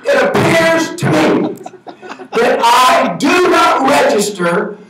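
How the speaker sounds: high, loud, very slow oration; hammed-up grand proclamation; added emphasis. Shown as a demonstration. Speaker hams the quote for the audience, slow, loud, high. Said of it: "this will add more emphasis".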